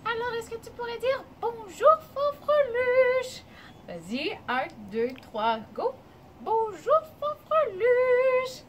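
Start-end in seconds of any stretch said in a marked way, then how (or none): 1.4-3.4 very high, loud little voice; tiny high voice. Shown as a demonstration. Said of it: "ta petite voix aiguë". 6.4-8.6 very high, loud little voice; tiny high voice. Shown as a demonstration. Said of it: "ta petite voix aiguë".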